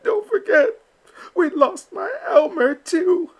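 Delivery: high, crying mother; a grieving mama duck, close to tears; tearful. Acted. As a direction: voice breaking on her lost son. Grieving mama remembers lost son.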